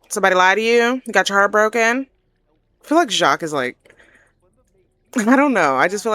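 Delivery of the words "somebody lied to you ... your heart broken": louder, higher incredulous questions; incredulous reaction. Acted. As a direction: pepper a friend with loud, disbelieving questions. Acting Jacques's blunt, indignant reaction.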